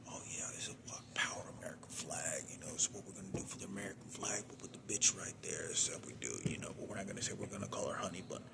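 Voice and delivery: fast, hushed whisper, running the words together; scheming voices whispering fast; whispered plotting. Retold or imitated. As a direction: rush it out in a conspiratorial whisper. Imagined voices keep plotting.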